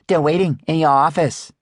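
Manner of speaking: voiced Ying. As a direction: play the cheerful young shop assistant. Ying's line in dialogue.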